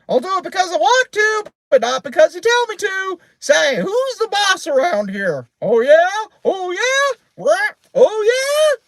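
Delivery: very high, loud defiant squawk; cheeky bird voice at full pitch; shouting parrot. A narrator's reading. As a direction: screech back like a bossy parrot. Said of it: "leaving Penelope still shouting".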